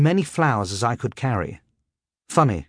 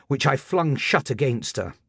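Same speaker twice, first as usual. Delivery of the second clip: ordinary delivery, nothing marked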